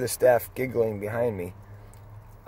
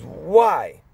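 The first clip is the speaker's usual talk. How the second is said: high, loud wary why; suspicious why; 7 semitones higher, 9 dB louder than usual. Retold or imitated. Resident questioning his odd question, a loud wary why. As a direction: demand a reason.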